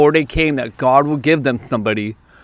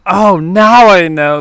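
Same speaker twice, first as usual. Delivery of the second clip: loud, high, drawn-out "oh, now I get it"; re-enacted realization; 6 semitones higher, 8 dB louder, slower than usual. Speaker finally recognizing the couple, re-enacted loud realization. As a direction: play up the moment it dawns on you, slow and loud. Acting out his sudden recognition.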